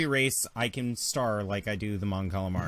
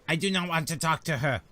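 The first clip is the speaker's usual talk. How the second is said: higher mock French take; French Nemodian accent; 4 semitones higher than usual. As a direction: switch to a hammed-up French alien. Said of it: "Now they're French Nemodians".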